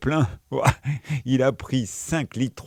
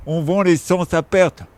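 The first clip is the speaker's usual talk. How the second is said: mocking politician; 7 semitones higher, 6 dB louder than usual.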